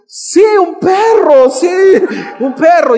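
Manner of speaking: high, loud, slow drawn-out groan of resignation; mock dismayed confirmation. Pastor to his congregation about the unwanted dog, loud mock dismay. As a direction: drag it out like an unwanted surprise.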